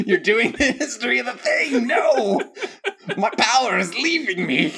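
loud, high mock wail with laughter; hammed-up despair. Host losing power over the history recap, hammed-up wailing. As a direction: wail it like a defeated villain.

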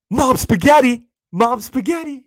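high, loud shouted chant; yelled. Retold or imitated. Two [name] fans yelling the famous words together. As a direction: yell it together like a shared joke. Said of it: "you yell the two words".

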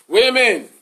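high drawn-out holler of women; loud call. Pretend.

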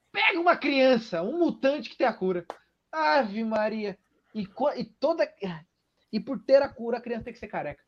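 loud, high-pitched ranting parody; hammed-up, exasperated outburst; mock exasperation. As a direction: pitch it up and rant at the absurdity. Mocking the writers' absurd plot idea.

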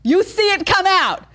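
very high, loud gleeful squeal; squealing delight. Real, not acted. Host teases Tyler about the video, giddy squeal.

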